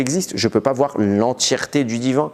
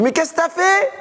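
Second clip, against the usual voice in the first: much higher, an accusing question; high stunned divine rebuke; God rebuking. Acted. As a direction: confront Cain as God.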